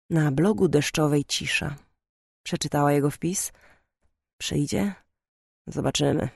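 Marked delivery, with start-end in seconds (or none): 4.4-6.3 muttered through teeth. A narrator's reading. Brother wondering about his blog reader, muttering softly. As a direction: mumble it to yourself. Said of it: "Mruknął przez zęby".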